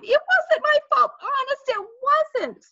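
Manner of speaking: put-on high, loud girlish protest; crying out defensively. A narrator's reading. Sheila defends herself after Fudge's fall, crying out. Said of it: "Sheila cried".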